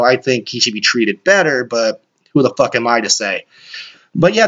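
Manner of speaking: ordinary talk, nothing marked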